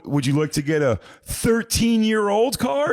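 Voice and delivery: high, slow leading question; hammed-up mock offer; taunting question. Sting crew cornering him at the dealership, mock taunting.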